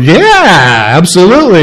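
a lightly voiced churchgoer cheerfully agreeing; mock congregation answer. Pastor imagining a listener's wrong answer, lightly mocking. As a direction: toss off a mock eager yes. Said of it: "if you went".

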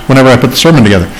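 usual speaking voice

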